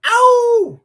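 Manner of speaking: a quick yelp of pain; squeaky cry of ow; sharp yelp. Real, not acted. Sudden exclamation mid-talk.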